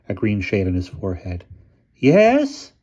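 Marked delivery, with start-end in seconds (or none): 1.9-2.7 high, thin station master voice; a thin, reedy little voice. A narrator's reading. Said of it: "he said in a thin voice".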